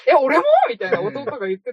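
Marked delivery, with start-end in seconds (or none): 0.1-0.4 higher, louder startled squeak with a laugh; mock surprise. Imitating the younger brother caught off guard, laughing mock surprise. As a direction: act out his disbelief with a laugh.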